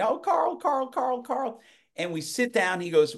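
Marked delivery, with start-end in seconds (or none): 0.0-1.6 very high-pitched mock greeting; chorus of waiters. Waiters all greet their regular customer, imitated in a high voice. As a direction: imitate waiters chiming a name repeatedly. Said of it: "They're all going".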